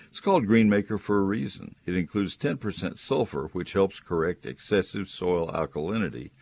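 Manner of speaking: upbeat radio ad read; chipper 1950s ad. Acted. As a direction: sell it like a cheerful announcer. Live read ad for sponsor.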